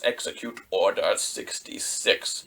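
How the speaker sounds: a hint of a cold, breathy Palpatine order; lightly voiced emperor giving the kill order; hushed command. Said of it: "he signals Commander Cody".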